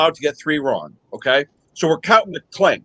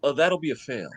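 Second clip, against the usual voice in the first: quiet, much lower, dead calm; deadpan examiner; 4 semitones lower, 8 dB quieter than usual. Retold or imitated. Examiner calmly announcing the failed test, deadpan and soft. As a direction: say it utterly unbothered. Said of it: "He goes".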